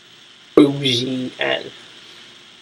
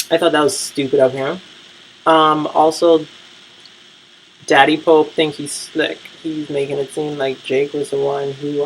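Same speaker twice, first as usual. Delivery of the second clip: ordinary talk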